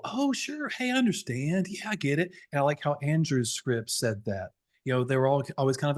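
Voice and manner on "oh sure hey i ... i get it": much higher and light, easy-going agreement; agreeable. Shown as a demonstration. Agent agreeing with a hesitant customer, mild and friendly. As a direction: sound like you're happily on their side. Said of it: "Always be kind of, like, agreeable".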